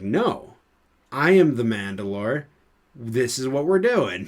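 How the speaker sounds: loud, emphatic retort with swooping pitch; sarcastic. Retold or imitated. Bo-Katan pulls rank on a scoffing Mando, sarcastic and forceful. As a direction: put your foot down as the indignant leader. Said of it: "so she sarcastically says it".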